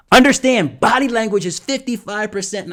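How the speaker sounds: loud, high-pitched proclamation; driving it home. Shown as a demonstration. Coach contrasting with the hush to drive a point home, loud. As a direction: burst out forcefully after the whisper. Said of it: "drive the point home".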